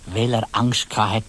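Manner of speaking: plain reading voice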